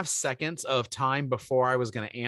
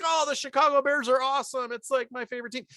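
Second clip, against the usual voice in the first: much higher, gushing mock-fan voice; fake enthusiasm; 11 semitones higher than usual. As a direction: overdo the excitement to please a client. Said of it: "I pretend to like".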